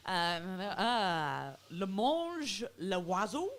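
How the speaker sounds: loud, halting stab at French. Shown as a demonstration. Comedian trying French after praising the fluent MC, halting French. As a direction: attempt French, not fluently. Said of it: "fluent a Frenchman as our lovely M C".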